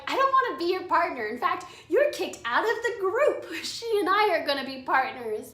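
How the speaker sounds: high, forceful playful rejection; half-joking call-out. Retold or imitated. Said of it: "I call him out on it".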